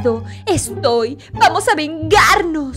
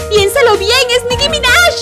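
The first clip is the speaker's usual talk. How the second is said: excited; 9 semitones higher, 3 dB louder than usual. Acted. Frantic warning, high pitch.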